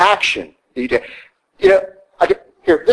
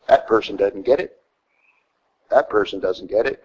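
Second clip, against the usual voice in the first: lower, softer judgmental murmur; judgmental aside; 7 semitones lower, 6 dB quieter than usual. Retold or imitated. Churchgoer judging people walking in, low quiet mutter. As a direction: imitate a snooty muttered verdict.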